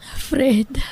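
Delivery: soft unvoiced whisper. Wife whispering to her injured husband in the hospital. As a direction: breathe his name.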